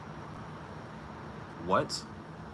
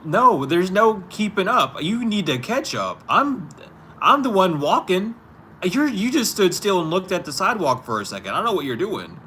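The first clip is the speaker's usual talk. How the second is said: much higher and louder, frustrated; exasperated loud arguing; 9 semitones higher, 7 dB louder than usual. Real, not acted. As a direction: push back hard, fed up and loud.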